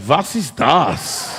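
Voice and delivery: slow, drawn-out "Was ist das?" in German; puzzled German voice, slowed; German question. Retold or imitated. German audience asks what is happening, slow puzzled German. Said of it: "German go".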